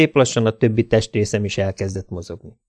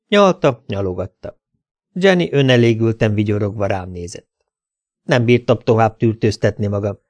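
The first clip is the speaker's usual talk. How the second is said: plain reading voice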